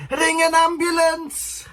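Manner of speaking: high, slow urgent cry for help; urgent call out. Retold or imitated. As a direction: call out like a panicked passer-by. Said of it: "some woman comes out and she goes".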